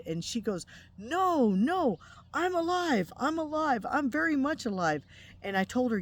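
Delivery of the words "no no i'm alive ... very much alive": much higher, louder, pleading I'm alive; insistent denial. Retold or imitated. Dead friend denying she died to the dreaming wife, high and insistent. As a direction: protest in disbelief, pitched way up.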